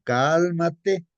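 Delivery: loud, high command of "Cálmate"; Jesus rebuking the storm, loud command. Retold or imitated. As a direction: order the storm to be still. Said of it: "le dice, ¿no?".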